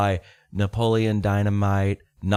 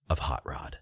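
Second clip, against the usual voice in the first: whispered reveal; 9 semitones lower, 10 dB quieter than usual. Real, not acted. Reveals movie title.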